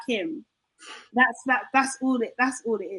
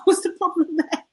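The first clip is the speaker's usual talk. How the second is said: higher, words breaking into laughter; laughing; 5 semitones higher than usual. Real, not acted. British actors being everywhere is no problem, laughing. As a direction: laugh through the question. Laughing at her own question.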